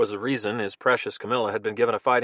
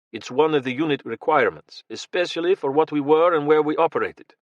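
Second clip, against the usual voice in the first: put-on thick Russian accent; 4 semitones higher than usual. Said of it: "in a thick Russian accent".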